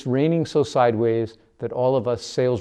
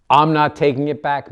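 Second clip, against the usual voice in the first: loud furious refusal; angry shout; 4 semitones higher, 10 dB louder than usual. A narrator's reading. Manny refusing Patty over the dog, loud angry shout. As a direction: yell it at her, no budging.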